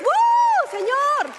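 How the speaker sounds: very high, loud whoop to the Lord; whoop, then call out at full voice; shout of praise. Shown as a demonstration. Preacher feels like crying out to God, exultant shout. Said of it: "me dan ganas a mí de hacer".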